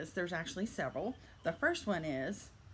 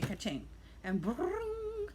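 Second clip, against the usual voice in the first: slow, high-pitched ka-ching and ringing; imitated phone ring; 11 semitones higher, much slower than usual. Seller mimicking her phone ringing with sales, high and drawn out.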